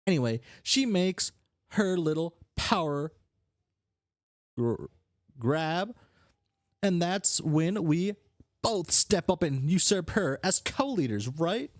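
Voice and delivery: scheming brash character. Acted. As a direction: spell out the coup to a partner.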